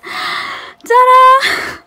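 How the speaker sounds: high, loud ta-da; gasp, then present it with a big squeal; excited fanfare, pitched right up; delighted reveal. Real, not acted.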